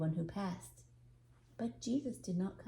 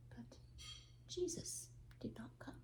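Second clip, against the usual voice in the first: breathy hush, much softer; whispered; 4 semitones higher, 14 dB quieter than usual. A narrator's reading. Narrator tells the shoemaker's disappointment in a whisper. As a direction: let the sad line fall to a whisper.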